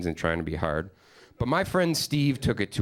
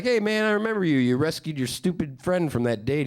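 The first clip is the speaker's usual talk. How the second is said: mock friendly; 7 semitones higher than usual. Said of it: "I'm like".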